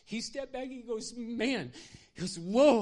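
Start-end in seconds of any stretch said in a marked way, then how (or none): 1.2-1.7 high, loud burst of amazement; overwhelmed. Retold or imitated. Worker after hugging the pastor, high loud amazement. 2.4-2.8 high, very loud cry of amazement; stunned whoa. Retold or imitated. Worker feeling electricity in his body, shouting whoa.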